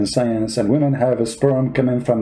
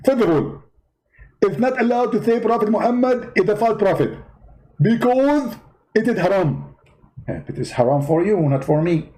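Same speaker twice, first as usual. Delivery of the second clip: high, indignant preacher voice; Zakir Naik impression; 6 semitones higher than usual. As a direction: play the offended debater, pitched well up. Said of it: "Zakir Naik?".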